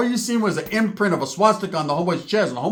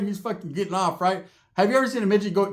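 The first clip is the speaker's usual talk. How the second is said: ordinary talk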